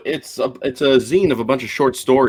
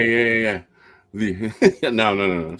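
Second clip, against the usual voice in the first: ordinary delivery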